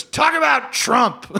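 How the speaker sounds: high, slow mock heckler yell; mock heckler shout. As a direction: bellow it across the bar. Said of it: "And he goes".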